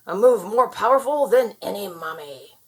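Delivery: plain reading voice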